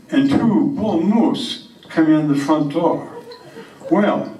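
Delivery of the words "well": ordinary talk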